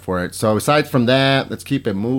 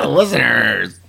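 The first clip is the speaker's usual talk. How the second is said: usual speaking voice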